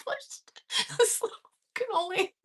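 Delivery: squeezed high voice through laughter; real giggling confession; helpless laughter. Real, not acted. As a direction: barely get the words out for laughing. Cracking up telling own story.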